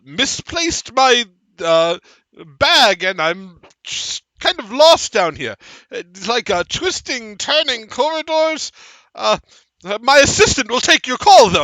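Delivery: caught-out servant stammering to his boss; flustered stammering minion. Acted. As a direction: bluff your way through, babbling high. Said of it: "nods and goes".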